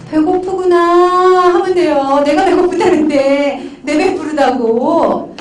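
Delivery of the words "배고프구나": slow, loud, drawn-out 배고프구나; showing the audience how to acknowledge hunger; empathic acknowledgment. Shown as a demonstration. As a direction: say it slowly, letting them feel heard. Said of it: "뭐라고 얘기하면 돼요?".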